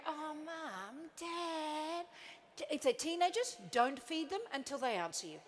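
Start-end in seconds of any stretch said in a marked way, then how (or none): 0.1-2.1 put-on whining kids. Kids groaning.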